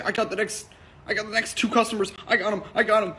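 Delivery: high, urgent imitation of an excited barista; excited eagerness. Barista claiming the next customers from his staff, excitedly.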